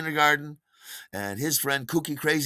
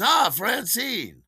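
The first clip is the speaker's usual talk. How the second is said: hearty greeting; 10 semitones higher, 4 dB louder, much slower than usual. Retold or imitated. Steve greets Francine, introducing Donald.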